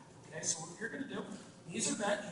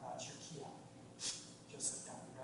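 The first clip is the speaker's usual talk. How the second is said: much softer, almost voiceless, just air; breathed-out kiai; 7 semitones lower, 14 dB quieter, slower than usual. Shown as a demonstration. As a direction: let the words out on a long exhale. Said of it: "what you're gonna do is breathe out".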